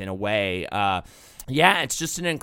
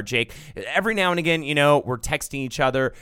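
ordinary talk

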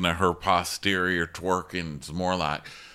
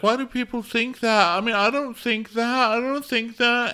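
very high whiny imitation of crying; hammed-up weepy falsetto; mock crying. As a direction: squeak it out as a tearful parody. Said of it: "so she cries".

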